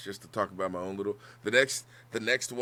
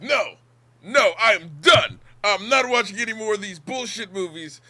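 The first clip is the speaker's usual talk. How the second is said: higher, louder, fed-up refusal; loud protest; 10 semitones higher, 10 dB louder than usual. Retold or imitated. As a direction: mimic a friend loudly refusing. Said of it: "Crumb was like".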